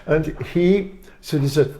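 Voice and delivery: ordinary delivery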